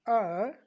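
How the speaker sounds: आर loud with a long vowel; demonstrated R. Shown as a demonstration. Teacher modelling R for students, long loud आर. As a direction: stretch the आ in आर. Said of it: "तो इस तरह से".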